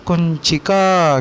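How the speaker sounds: कुंचिका drawn out syllable by syllable; कुंचिका धीरे-धीरे; slow teaching word. Shown as a demonstration. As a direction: say कुंचिका slowly for pupils to repeat. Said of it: "उसे हम संस्कृत में बोलते हैं".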